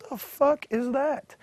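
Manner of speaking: slow, high-pitched disbelief; retold incredulous question, drawn out; baffled. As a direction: stretch it in mock bewilderment. Retold bafflement at cowboy entrance.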